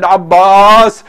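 loud, drawn-out summons; stretched, ringing call. Retold or imitated. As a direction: call the name across the camp. Hussein calls out to Abbas.

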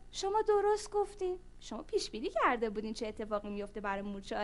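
ordinary delivery

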